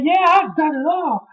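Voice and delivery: high mock taunt; The Rock impression. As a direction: sneer it in a squeaky voice. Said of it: "He's like".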